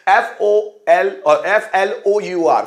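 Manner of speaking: slow, letter by letter; slow spelling. Shown as a demonstration. Teacher spelling flour for students. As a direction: spell each letter clearly. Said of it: "spelling aloud".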